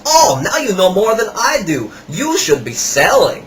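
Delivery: lightly voiced flattering seller; imitating the salesman. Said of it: "He goes".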